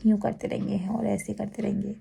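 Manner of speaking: soft, hushed lulling voice; gentle cooing as if patting someone to sleep; soothing murmur. Retold or imitated. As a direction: murmur it like rocking a child to sleep. Showing the sister's soothing pats.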